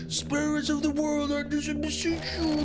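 very high, slow anime hero cry; Goku voice. Retold or imitated. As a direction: call out to the spirits like Goku. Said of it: "and you're like".

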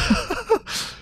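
a sudden high squeaky laugh after a scare; high, breathy nervous giggle; startled laugh. Real, not acted.

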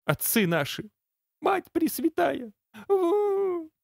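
high pleading cry in pain; high tearful praying wail. Acted.

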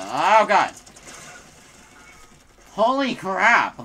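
loud shocked outburst, slow on crap; startled shout. Real, not acted. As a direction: blurt it out in real shock. Getting killed by enemies.

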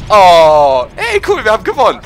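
loud, high cheer of victory; excited. Real, not acted. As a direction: shout it out, thrilled. Match just won.

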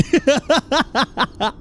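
amused burst of laughter; a run of chuckles; laughing. Real, not acted. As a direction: laugh out at the absurdity. Laughing at the game's instructions.

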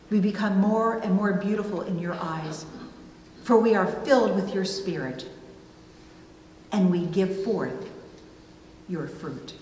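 usual speaking voice

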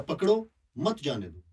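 पकड़ो alone, pause, then मत जाने दो; pause after पकड़ो. Shown as a demonstration. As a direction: put the comma right after पकड़ो. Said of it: "मारो के बाद अगर कॉमा लगा देंगे".

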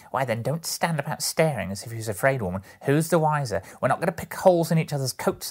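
loud, high scolding charwoman; brazen woman, impatient. Acted. Woman scolds the others for staring, brazen and impatient.

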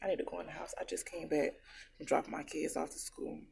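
breathless, soft and winded; out of breath. Real, not acted. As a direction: talk while catching breath. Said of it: "I'm out of breath now".

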